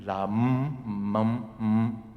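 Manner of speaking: usual speaking voice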